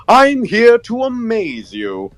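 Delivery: really energetic high cartoon voice; high, drawn-out showman with extra energy; energetic character voice, stretched out high; Buzu. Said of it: "just bring back the energy a bit".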